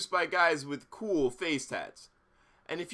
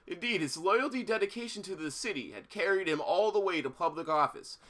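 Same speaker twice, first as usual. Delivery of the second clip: ordinary talk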